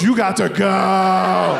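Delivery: wife's verdict. Retold or imitated. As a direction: drag it out like a final ruling. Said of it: "My wife looks at me and goes".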